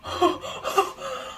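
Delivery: breathy high gasp; gasp of awe. Real, not acted. Vlogger gasping at a huge leaf.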